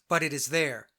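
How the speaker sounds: normal talking voice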